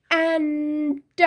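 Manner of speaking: ant drawn out slowly; slow model word. Shown as a demonstration. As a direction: say অ্যান্ট slowly for the class to copy. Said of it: "এর উচ্চারণ হবে". (অ্যান্ট ধীরে টেনে বলা)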